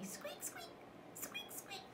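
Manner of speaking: tiny, very soft, high squeaks; be a little mouse squeaking. Shown as a demonstration. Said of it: "Make the noise".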